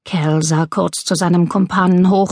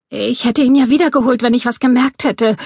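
higher, defensive pleading; anxious protest; 6 semitones higher than usual. A narrator's reading. Burglar defending himself to his angry partner, anxious protest. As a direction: protest nervously that it was unintended.

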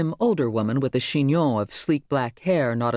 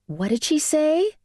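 onlooker asking; 14 semitones higher than usual. A narrator's reading. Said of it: "people had to ask each other".

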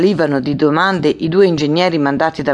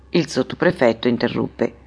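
usual speaking voice

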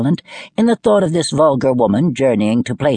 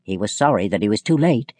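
plain reading voice

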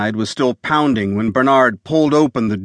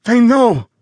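high, loud, breathless warning; gasped; 11 semitones higher, 5 dB louder than usual. Acted. Dying visitor warning Bernard, gasping in fear. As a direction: gasp it out in terror. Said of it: "the man gasped".

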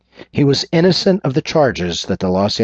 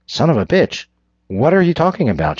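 matching his angry tone; 7 semitones higher than usual. A narrator's reading. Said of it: "she said, matching his tone".

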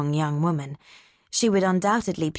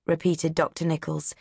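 plain reading voice

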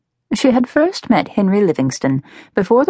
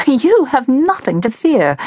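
higher, slow, amused delivery; laughing reassurance; 7 semitones higher, slower than usual. A narrator's reading. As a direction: laugh it off to your sister, drawn out. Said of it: "She laughed at her sister".

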